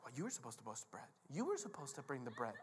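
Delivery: low-volume squabbling aside; hushed, petty blame; bickering disciples. Acted. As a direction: grumble it under your breath at a crewmate. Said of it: "stupid disciples are in the boat like".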